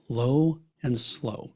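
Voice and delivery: slow, unhurried delivery; low and slow. Shown as a demonstration. Speaker showing advisors how brisket talk sounds, slow and calm. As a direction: let each word sit and cook. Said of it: "when you think about brisket".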